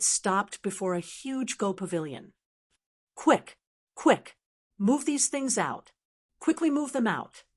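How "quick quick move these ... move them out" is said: high-pitched, hurrying commands; urgent order. A narrator's reading. Official rushing workers out of the shop, urgent order.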